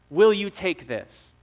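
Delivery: normal talking voice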